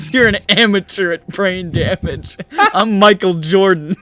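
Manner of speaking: loud, high trash talk through laughter; out-boast your rival, loud and playful; hammed-up champion's brag; mock boasting. Mock boast about brain damage.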